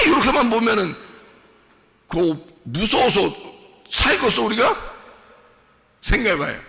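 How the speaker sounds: loud, high fervent sermon; rising revival preacher's cry; full-force preaching. Acted. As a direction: preach it to the back row. Preaching continues.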